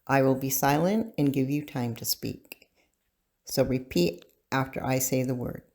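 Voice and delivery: usual speaking voice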